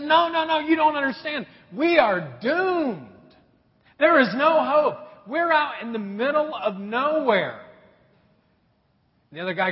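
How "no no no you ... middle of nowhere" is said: high, loud desperate insisting; desperate shout. Retold or imitated. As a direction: cry out that all hope is gone. Panicked friend insists they're doomed.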